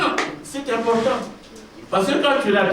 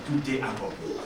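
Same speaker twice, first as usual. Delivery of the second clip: softer and lower, a near-breathed last word; hushed quote of Jesus; 12 semitones lower, 10 dB quieter than usual. Retold or imitated. Pastor quoting Jesus on the cross, softer and lower. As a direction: let it fall away like a dying breath. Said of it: "Jésus dit à son Père".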